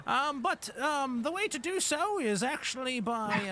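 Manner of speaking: put-on high, creaky old goblin greeting; elderly goblin. Old goblin at the ticket booth greets the party, high and doddering.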